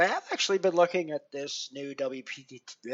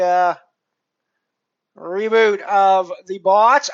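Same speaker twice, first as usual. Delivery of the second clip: ordinary delivery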